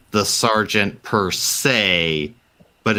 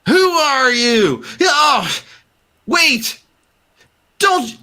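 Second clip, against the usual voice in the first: high, panicky voice that slows on the first question; a scared man running from the saloon; frightened stranger; 3 dB louder, slower than usual. Acted. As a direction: call out scared, then catch yourself. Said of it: "and is like".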